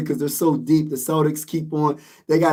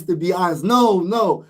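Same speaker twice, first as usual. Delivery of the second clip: ordinary delivery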